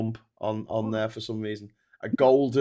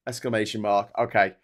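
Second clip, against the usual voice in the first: ordinary talk, nothing marked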